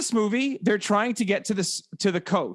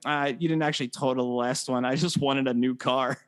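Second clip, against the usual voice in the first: low, subdued mock confession; imitated movie husband; 6 semitones lower than usual. Husband confessing to his wife, imitated low voice. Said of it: "William H. Macy being like".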